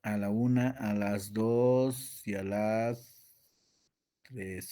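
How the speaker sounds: drawn-out slow count; slow countdown. Real, not acted. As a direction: stretch the count like a game. Cuenta lenta para buscar. (cuenta lenta y alargada)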